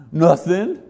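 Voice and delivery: laughing, caught-out nothing; guilty kid blurting nothing; sheepish Adam. Retold or imitated. Adam answering God, sheepish and laughing. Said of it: "Adam answering God".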